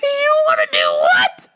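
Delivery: very high disbelieving shriek; shocked shriek. Retold or imitated. Daughter shrieking at dad's plan in shock. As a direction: squeal in disbelief.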